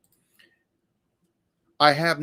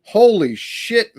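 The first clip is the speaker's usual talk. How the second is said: drawn-out, higher-pitched astonished swear; slow, real disbelief at a viewer's kindness; stunned amazement; 8 semitones higher, much slower than usual. Real, not acted. Stunned reaction to a viewer's generosity.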